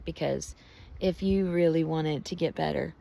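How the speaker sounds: normal talking voice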